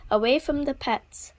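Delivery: plain reading voice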